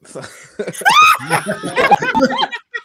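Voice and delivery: high shrieking laugh; screaming laughter. Real, not acted. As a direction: howl with laughter. Huge laugh at midnight joke.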